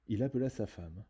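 usual speaking voice

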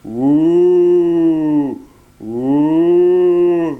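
loud howl calling the pack; wolf calling his brothers, howling. A narrator's reading. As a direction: howl for help. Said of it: "il se mit à hurler".

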